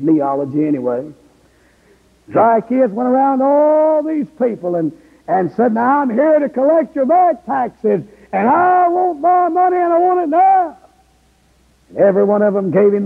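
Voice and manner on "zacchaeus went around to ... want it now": high, pressing preacher voicing a greedy collector; a touch of a greedy tax man; demanding tax collector. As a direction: push the demand up and hard.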